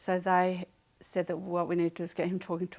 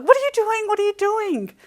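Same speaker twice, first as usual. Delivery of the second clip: high, loud panicked yell; mother yelling at her son on the couch, high and loud; yelling in alarm; 13 semitones higher, 12 dB louder than usual. Retold or imitated. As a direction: shout at the kid in fright. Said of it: "yelling at him".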